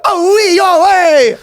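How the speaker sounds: high, loud cartoon Italian cry; hammed-up Italian exclaiming; Italian accent. Italian translator calling out to his community, cartoon Italian accent. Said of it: "then the Italian guy goes".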